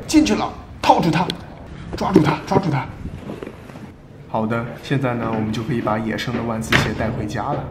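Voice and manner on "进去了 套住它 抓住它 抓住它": excited. Pretend. Trap springs on the prey.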